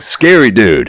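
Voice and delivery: ordinary talk, nothing marked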